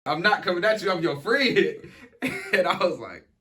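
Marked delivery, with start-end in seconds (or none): none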